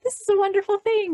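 delighted thought; delighted inner thought. Retold or imitated. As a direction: quote your own happy thought up high. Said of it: "And I always think".

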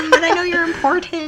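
high, fast mocking voice; mock awkward voice. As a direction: sneer it quickly up high. Mocking Legolas's awkward greeting.

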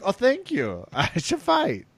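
high, soft mimicked voice; Barbosa impression. Host imitating a fighter grateful for praise, high mimicked voice. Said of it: "good impression there".

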